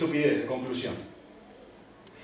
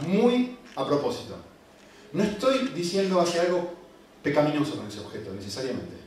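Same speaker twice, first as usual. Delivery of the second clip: ordinary talk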